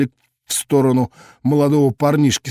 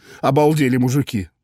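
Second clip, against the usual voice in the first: usual speaking voice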